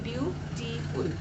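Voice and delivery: Beautiful in three separated beats; syllable by syllable. Shown as a demonstration. Teacher pronouncing for pupils to count, syllable by syllable. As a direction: pause after view and टी in beautiful. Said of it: "देखो मैं उच्चारण कर रही हूँ".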